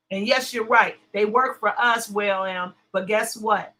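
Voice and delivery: ordinary delivery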